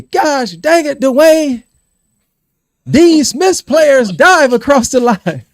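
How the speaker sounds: loud, high-pitched angry coach yell; mad coach. Retold or imitated. Coach yelling at a player slowing up at the line, mad. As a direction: bellow it at the player, stretching the words out. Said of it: "he was mad at us".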